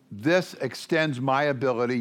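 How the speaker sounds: ordinary talk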